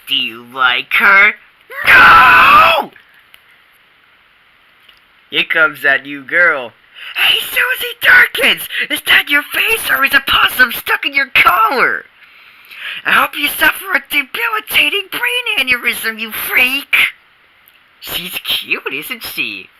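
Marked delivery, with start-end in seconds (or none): 1.9-2.9 sudden high shout of NO; shouted denial. Acted. Boy shouts his denial to his friend, loud and high. Denying he likes new girl. 7.2-17.0 high, jeering taunts; taunting. Acted. Boy jeers at the new girl Susie, high and mocking.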